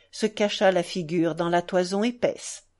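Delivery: ordinary delivery, nothing marked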